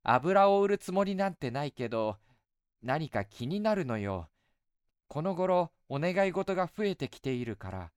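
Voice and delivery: put-on light, coaxing young woman; goddess voice. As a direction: sweetly tease an old friend. Goddess speaks.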